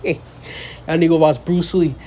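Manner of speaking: ordinary talk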